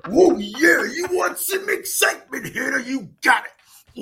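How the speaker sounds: hammed-up Macho Man hyping up the crowd; Macho Man impression. Imitating Macho Man to host Hannah, roaring wrestler promo. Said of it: "Ooh, Macho Man Randy Savage".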